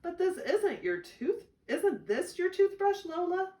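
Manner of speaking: big brother pointing out the obvious; older brother catching his sister's fib; surprised big brother. Acted.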